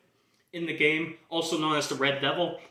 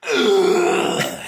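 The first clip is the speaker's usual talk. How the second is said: loud guttural metal growl; bad [name] impression; 5 semitones higher, 9 dB louder than usual. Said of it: "that's a really bad impression of [name]".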